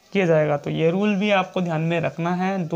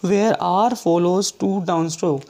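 very slow reading of the rule; teacher dictating the book's rule; slow dictation; much slower than usual. Shown as a demonstration. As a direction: read each word for notes. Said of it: "ये point में लिखा गया था".

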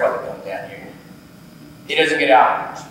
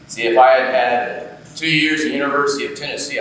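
usual speaking voice

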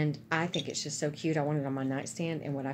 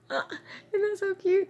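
high, loud delighted squeal; excited squeal; 5 dB louder than usual. Real, not acted. Gushing to viewers over a cute gift, in a high, loud squeal.

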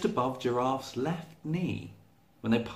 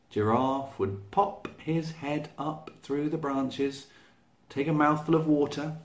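ordinary delivery

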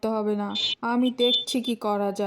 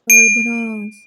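panicked cry, loud; terrified shout; 12 dB louder, much slower than usual. Acted. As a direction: yell it in sudden terror. Tiger terrified by the threat.

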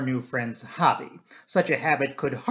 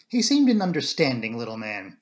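ordinary delivery

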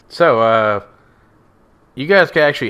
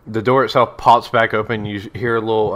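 usual speaking voice

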